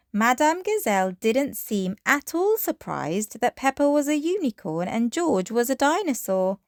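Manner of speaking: ordinary delivery, nothing marked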